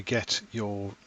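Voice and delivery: normal talking voice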